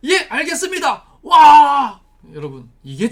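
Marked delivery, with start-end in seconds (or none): 0.1-1.1 brisk, raised soldier-like answer; eager, dutiful acknowledgement; obedient reply. Acted. Acting obedient reply to God's order. 1.2-2.0 huge, high wordless shout; full-force acted battle yell; loud war cry. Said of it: "큰 소리를 외쳐라".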